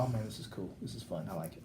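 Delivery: low, soft mumbled imitation of a reluctant friend; hint of a friend who hates everything, muttering; mock grudging Tim. Mimicking Tim for the cohost, low and soft grudging. Mocking Tim's reluctant enjoyment.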